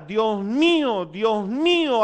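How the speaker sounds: high, very slow, aching plea; anguished cry. Acted. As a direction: cry it out like a man in agony. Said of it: "gritó desde la cruz con dolor y agonía".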